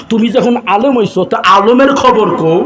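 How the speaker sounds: normal talking voice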